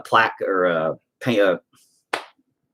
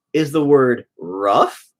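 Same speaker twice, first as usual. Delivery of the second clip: ordinary talk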